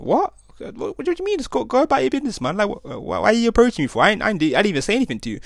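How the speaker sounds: high, squeaky disbelief, then rushing; incredulous protest. Retold or imitated. To the guys squaring up to him outside the bar, shrill incredulous protest. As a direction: protest wide-eyed that you did nothing, racing at the end.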